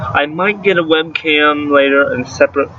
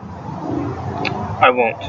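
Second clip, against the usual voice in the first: whispered firm refusal; whispered aside; 7 dB quieter than usual. Real, not acted. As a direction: breathe it out as an aside.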